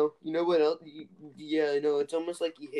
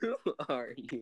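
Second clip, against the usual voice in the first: quiet echo; 9 dB quieter than usual. Retold or imitated. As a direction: murmur it back. Friend echoes quote softly.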